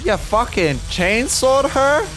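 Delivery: high, slowed, shocked outburst; can't believe what he just saw; shocked disbelief. Real, not acted. Player reacting to a sudden game kill, shocked high outburst.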